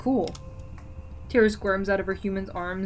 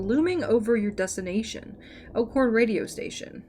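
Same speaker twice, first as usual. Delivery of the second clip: ordinary talk, nothing marked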